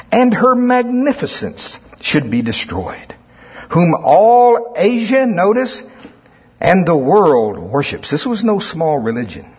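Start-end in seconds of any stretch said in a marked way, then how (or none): 0.1-8.1 high, slow, stretched-out oratory; declamatory scripture reading. Acted. Preacher voicing the craftsman's alarm for Diana, declamatory. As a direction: proclaim it grandly, drawing out the words.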